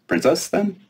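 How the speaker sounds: put-on high, bright-eyed fairy girl, teasing. Fairy princess quizzing the giant girl, high and playful.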